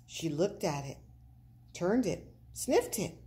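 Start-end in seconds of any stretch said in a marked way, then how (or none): none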